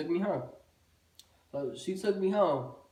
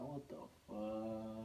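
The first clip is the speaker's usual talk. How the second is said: hushed disbelief; 6 semitones lower, 15 dB quieter, much slower than usual. Retold or imitated. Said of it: "muttered, stunned".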